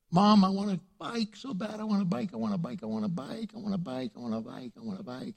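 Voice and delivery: put-on pleading child, dying away; whining kid. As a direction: whine like a kid who won't quit, trailing off. Child begging mother for bike.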